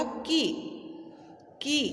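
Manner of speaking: की with a high, stretched ई; long ई in की. Shown as a demonstration. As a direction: sound out की clearly with a long ई. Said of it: "जब मैं की उच्चारण करती हूँ तो ई का आवाज़ आता है".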